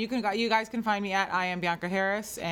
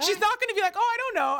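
high, airy mock clueless voice; 14 semitones higher, 5 dB louder than usual. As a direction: play it dumb and high-pitched. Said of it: "That's why I was like".

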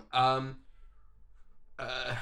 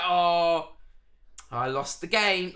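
loud, slow, higher-pitched concession; hammed-up "aw shucks" surrender; mock game loser; 4 semitones higher, 7 dB louder, much slower than usual. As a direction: mimic a bad actor admitting defeat. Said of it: "he played it like".

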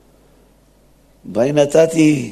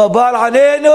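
raised, higher cry to Baal; mock shouted plea; 8 semitones higher, 4 dB louder than usual. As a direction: call out to the idol, pleading. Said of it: "הם צעקו".

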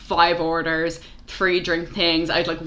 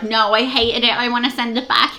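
higher, mildly mocking complaint; complaining customer; 5 semitones higher, 4 dB louder than usual. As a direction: imitate a customer sending food back.

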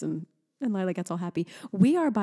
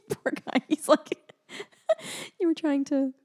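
laughing; 8 semitones higher than usual. Real, not acted. Laughing at sick husband.

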